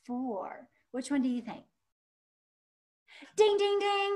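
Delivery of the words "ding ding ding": game-show ding. Acted. Teacher marks right answer.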